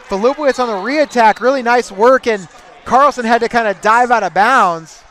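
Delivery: loud, high rapid-fire match call; excited play-by-play. Acted. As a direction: call the action as it happens. Live wrestling play-by-play.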